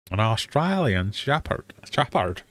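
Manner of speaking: slow, overdone fake Australian accent with broad vowels; badly faked Australian, "Shepherd" bent towards "shappard"; attempted Australian accent. Quiz host fumbling a dog breed, bad Australian accent. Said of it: "That was my fabulous Australian".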